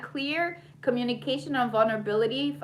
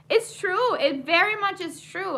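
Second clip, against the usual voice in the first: excited; 9 semitones higher, 5 dB louder than usual. Real, not acted. Enthusiastic agreement.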